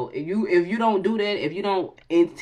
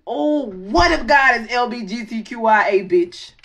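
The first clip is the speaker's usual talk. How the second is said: excited joke; 5 semitones higher, 6 dB louder than usual. Real, not acted.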